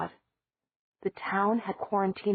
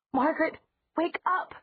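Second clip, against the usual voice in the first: high urgent shout; 11 semitones higher than usual. Acted. Elena shouts at the window to wake Margaret, high urgent yell. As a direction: yell her awake, rising at the end. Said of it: "Elena shouted".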